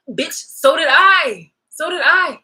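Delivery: slow, loud and biting; loud retort. Real, not acted. Answering the one claiming a hard life, loud and slow. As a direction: throw it back at them, hard. Angry retort to a sob story.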